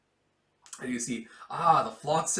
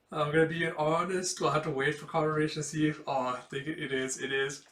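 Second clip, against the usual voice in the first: usual speaking voice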